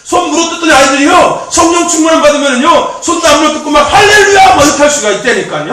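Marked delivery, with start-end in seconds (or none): 3.8-4.6 high, loud cry of hallelujah; joyful shout. Retold or imitated. Preacher acting out a spirit-filled boy, loud exultant shout. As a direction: burst out with hallelujah like a fired-up teen.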